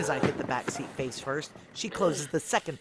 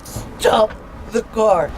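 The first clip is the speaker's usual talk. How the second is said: slow, loud, high command; desperate demand; shouted order; 8 semitones higher, 13 dB louder, much slower than usual. Acted. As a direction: drag it out as a yelled order. Demanding car stop.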